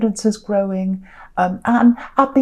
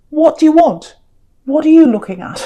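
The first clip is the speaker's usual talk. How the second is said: louder, higher, a wary snapping challenge; like someone guarding himself, calling over his shoulder; defensive challenge; 6 semitones higher, 7 dB louder than usual. Pretend. Card figure glaring at the King of Cups, defensive and louder. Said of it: "And, and you're saying".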